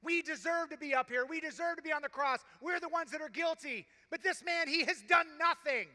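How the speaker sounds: the second criminal rebuking the first, loud and urgent; rebuke. Acted. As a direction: scold him hard, pleading and stern at once. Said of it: "he rebukes the other criminal".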